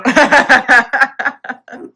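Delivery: bright burst of laughter; laughing. Real, not acted. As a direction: laugh out loud.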